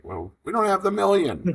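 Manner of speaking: imitating dad. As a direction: play the dad admitting they're broke.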